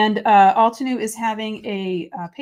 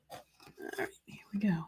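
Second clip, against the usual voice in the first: whispered under the breath; hushed whisper to herself; 14 dB quieter than usual. Real, not acted. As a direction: breathe it, focused on the work. Quietly starting a tricky step.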